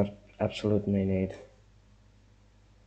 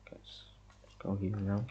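hushed call to viewers, barely voiced; whisper; 8 dB quieter than usual. Real, not acted. Player whispering to viewers in a dark building, hushed. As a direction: whisper to the guys so nothing hears.

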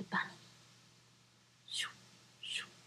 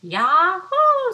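high, loud joyful whoop; excited yahoo; 12 semitones higher, 16 dB louder than usual. Acted. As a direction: cheer it like a bouncy bunny. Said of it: "said the bunny".

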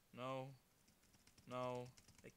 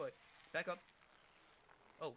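ordinary talk

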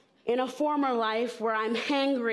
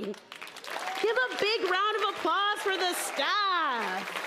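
high, drawn-out rallying call; rally the crowd, high and slow; calling for applause; 7 semitones higher, slower than usual. Real, not acted. Speaker to the audience about volunteer staff, rallying.

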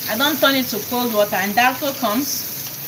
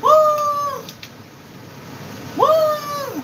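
high excited woo; delighted whoop; 13 semitones higher than usual. Real, not acted. Craving nchon soup, a very high delighted whoop. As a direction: whoop with joy at the thought.

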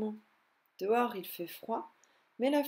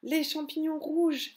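higher, louder, slowed wonder; excited exclamation; 6 semitones higher, 4 dB louder, slower than usual. Acted. Nick showing his siblings red mushrooms, excited. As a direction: point out the mushrooms in delight. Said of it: "s'exclame Nick".

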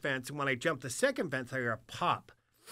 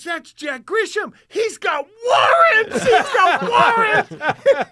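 very high, loud, slow shriek; imitated screaming; 13 dB louder, much slower than usual. Pat screaming the buddy's name to the cop, shrill. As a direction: scream it like a betrayed drunk. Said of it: "He's screaming".

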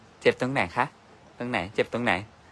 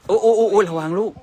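alarmed shout; 9 semitones higher, 7 dB louder than usual. Real, not acted. Mother sees child about to fall.

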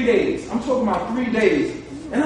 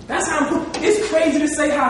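normal talking voice

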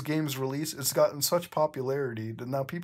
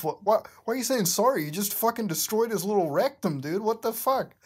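fast, higher, louder disbelief; incredulous rant; 6 semitones higher, 3 dB louder than usual. Real, not acted. Reader reacting to the fanfic, fast outraged disbelief. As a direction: rattle it off in outrage. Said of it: "reader's incredulous rant".

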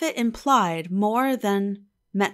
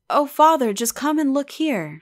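higher and louder, a sudden startled call; surprised exclamation; 6 semitones higher, 6 dB louder than usual. A narrator's reading. Eppie to her father at the pit, exclaiming in surprise. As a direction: cry out in surprise at what she sees. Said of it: "she exclaimed".

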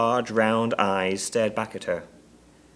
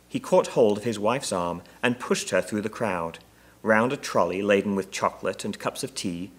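plain reading voice

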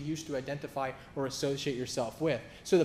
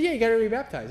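mock pushy official, higher and louder; a hint of a stubborn church official; insistent church man; 7 semitones higher, 7 dB louder, faster than usual. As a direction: imitate the pastor laying down the rule. Church man insisting, retold quarrel.

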